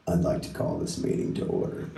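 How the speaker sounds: a hint of a pompous father; formal dad. Greg's dad opening the parents' meeting, lightly voiced low formal tone. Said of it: "is what Greg's dad said".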